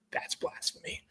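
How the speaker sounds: hushed mock outrage, barely voiced; whispered aside. Real, not acted. Whispering to his cohost about Max's take. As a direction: whisper it like a scandal.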